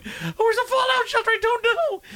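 high, laughing mock panic; a hammed-up frightened family. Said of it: "And they're like".

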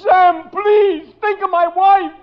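high, frantic pleading; desperate begging. Acted. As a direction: beg him not to send you to jail. Gangster begs desperately.

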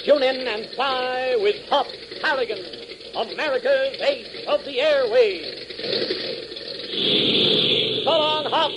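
high, slowed, ringing announcer close; announcer signing off the adventure serial; radio sign-off. Acted. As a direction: sell next week's episode. Show's closing sign-off.

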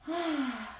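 long sighing breath out; audible exhale. Shown as a demonstration. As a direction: let the air go with an ah. Breathing exercise, exhale sound. (exhalación con suspiro)

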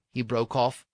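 plain reading voice

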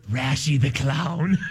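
a hint of a creepy clown, slow; spooky clown voice. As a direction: drag the name out like a creepy clown. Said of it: "and he said".

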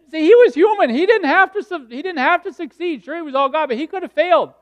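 loud, high-pitched, urgent explanation; impassioned preaching. Real, not acted. Preacher explaining that Jesus was human, impassioned and high. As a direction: press the point home at a high pitch.

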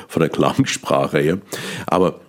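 ordinary talk, nothing marked

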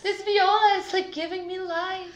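high, loud gushing with a cracked voice; voice cracking. Real, not acted. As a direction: gush about the music, voice breaking. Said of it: "Pardon my voice crack".

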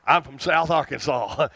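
ordinary delivery

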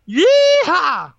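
cowboy whoop, high and loud; yeehaw. Acted. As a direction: let out a rodeo yeehaw. Host hypes the big news.

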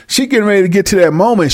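usual speaking voice

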